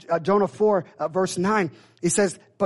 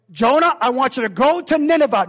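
booming divine command; 5 semitones higher, 6 dB louder than usual. Acted. God's command voiced again, loudly.

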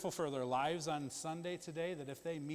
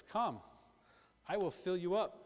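voicing God; 5 semitones higher, 3 dB louder than usual. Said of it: "I read the verses".

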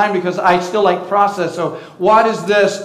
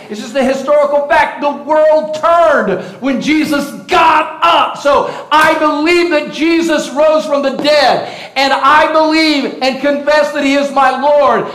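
preaching at full force; 6 semitones higher, 3 dB louder than usual. Acted. Pastor proclaiming the resurrection to his church, in full preaching force. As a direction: thunder it out to the congregation.

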